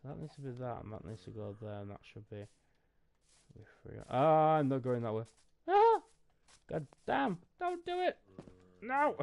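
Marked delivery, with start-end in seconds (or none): 4.1-9.2 high, loud panicked yells at the game; startled yelling. Real, not acted. Gamer yelling as his build collapses, loud alarm. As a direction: shout in alarm as it goes wrong. Gaming mishap, startled outburst.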